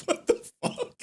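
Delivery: high, voiceless retold shock; amused mock disbelief, squeaked; stunned disbelief. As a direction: gasp it out through suppressed laughter. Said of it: "I was like".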